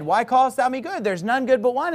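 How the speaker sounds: mocking take of the verse; mocking imitation. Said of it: "point to that and say".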